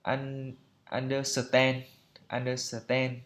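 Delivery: understand as "ăn-đơ-sờ-ten", an extra syllable for s; Vietnamese-style reading. Shown as a demonstration. Teacher mimicking untrained learners, Vietnamese-style understand. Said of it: "phiên âm Việt Nam như là".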